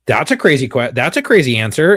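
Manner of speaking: emphatic mock disbelief; retold disbelief. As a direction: act out the wife's incredulous outburst. Wife's shocked reaction, retold.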